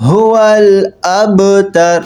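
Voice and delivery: هو الابتر slow, with a thin light ta. Shown as a demonstration. Teacher models the ending هو الابتر. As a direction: recite هو الابتر slowly with a light ta. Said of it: "تا کو باریک پڑھنی ہے".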